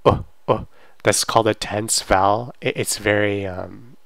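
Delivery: usual speaking voice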